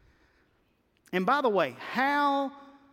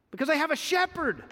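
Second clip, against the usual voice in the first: ordinary talk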